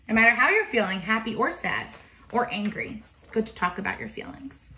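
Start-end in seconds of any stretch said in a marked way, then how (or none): none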